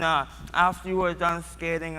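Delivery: ordinary talk, nothing marked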